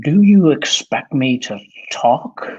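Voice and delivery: slow, hammed-up Sean Connery accent. Shown as a demonstration. Guest performs the Bond line on request, Sean Connery accent. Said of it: "In your best, uh, Sean Connery accent".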